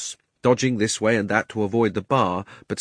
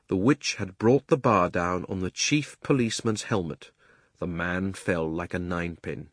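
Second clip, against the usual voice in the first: ordinary delivery, nothing marked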